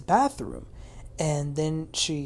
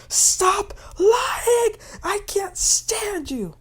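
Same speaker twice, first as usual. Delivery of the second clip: high, loud mock scream; 4 dB louder, much slower than usual. Retelling a castmate going off the charts, screaming. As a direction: screech it in imitation of a furious castmate. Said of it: "goes off the charts screaming".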